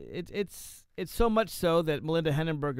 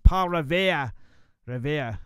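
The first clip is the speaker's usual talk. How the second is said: loud mock Boston accent, dropping the R; lousy Boston accent; 3 semitones higher, 8 dB louder, slower than usual. Shown as a demonstration. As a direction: belt out "Revere" in a bad Boston accent. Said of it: "I do a lousy Boston accent".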